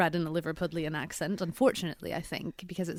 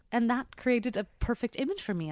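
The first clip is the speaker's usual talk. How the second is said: ordinary delivery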